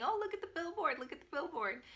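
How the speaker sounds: high, excited imitation; mimicked excitement. Husband mimicking his wife spotting the yarn sign, mock excited. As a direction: imitate someone eagerly pointing something out.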